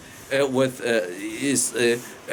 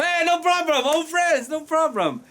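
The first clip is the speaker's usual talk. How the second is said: a much higher, breezy official promising easily; the rich official brushing off his old friend with a lofty, higher voice; smug rich official; 11 semitones higher than usual. Retold or imitated. Rich official reassuring his poor friend, lightly mocking and high-pitched. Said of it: "the high officer".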